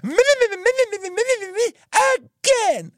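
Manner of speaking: high crying mom babble; mom wailing. Retold or imitated. Mom wailing to dad about the accident, very high cry. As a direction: whine it like a mom in hysterics. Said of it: "here my mom goes".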